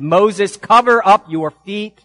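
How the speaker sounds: loud, high commanding call; crying out. Acted. God's voice calling to Moses from the bush, crying out. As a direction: call it out loud, stretching the words. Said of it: "the voice cried out and said".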